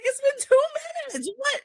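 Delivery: very high, squealed incredulity; shocked disbelief. Retold or imitated. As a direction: act out outraged astonishment, voice shooting up. Acts out her shocked reaction.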